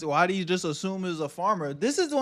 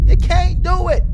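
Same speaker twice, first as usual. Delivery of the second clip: louder, firm repeat; forceful loud no; loud insistence; 10 semitones higher, 10 dB louder than usual. Real, not acted. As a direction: shout the rule down. Repeats the rule more forcefully.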